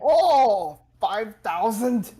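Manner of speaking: high, loud, drawn-out shock; loud disbelief. Real, not acted. Friend reacting to a five thousand ping, loud disbelief. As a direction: stretch it out in loud astonishment.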